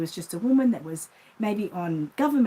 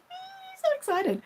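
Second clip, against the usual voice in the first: much higher, squealing with glee; bubbling high-pitched excitement; excited squeal; slower than usual. Retold or imitated. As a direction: squeal it like a giddy kid. Google confirms lucky seven.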